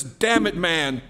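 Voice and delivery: curse, then deny it slowly and forcefully; acted angry disavowal; cursing denial. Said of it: "with a curse this time says".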